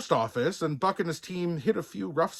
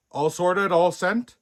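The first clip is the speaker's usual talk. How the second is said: a hint of a gruff boss checking in; lightly voiced bear boss asking; brisk, slightly raised check-in; 5 semitones higher, 5 dB louder than usual. A narrator's reading. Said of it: "asked Buck".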